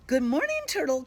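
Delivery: loud, high, cheerful call. A narrator's reading. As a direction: call across the woods to a friend. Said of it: "calls out Skunk".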